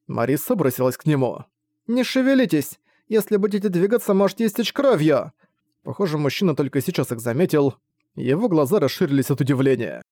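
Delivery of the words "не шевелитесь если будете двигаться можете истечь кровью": high, urgent call to stop; urgent shout. Acted. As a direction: cry out to keep him still. Marissa urgently warns wounded man.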